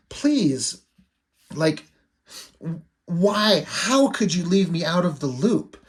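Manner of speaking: drawn-out, mildly exasperated plea; hurt pleading. Acted. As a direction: plead as a sister left out. Hurt sister pleads in acted confrontation.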